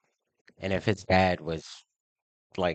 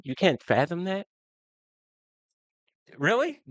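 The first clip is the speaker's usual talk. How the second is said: incredulous; 10 semitones higher than usual. Real, not acted. Rising disbelief at people in chat. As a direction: voice climbing high in disbelief.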